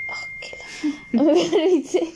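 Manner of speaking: laughing through the words; can't hold back a laugh. Real, not acted. Breaks up laughing mid-roleplay.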